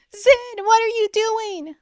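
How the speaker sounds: high, loud alarmed sister; crying out. A narrator's reading. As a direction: shout in shock. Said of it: "Ren cried".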